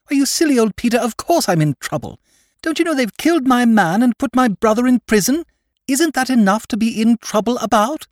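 high, loud, overwrought heroine voice; melodramatic young woman. Acted. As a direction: play it like a blood and thunder novel. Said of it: "talking like somebody in a Blood and Thunder novel".

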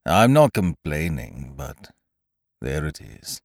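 doubt the whole morning; gloomy Eeyore. A narrator's reading. Eeyore doubts Pooh's good morning, low and mournful. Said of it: "went on Eeyore gloomily".

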